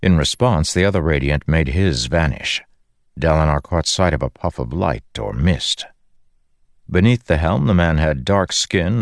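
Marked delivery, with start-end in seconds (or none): none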